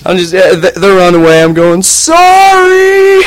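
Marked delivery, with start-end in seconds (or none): 1.8-3.2 very high sing-song mock apology; mock sorry. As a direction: call out a fake sorry to the fleeing kids. Apologizing to fleeing kids.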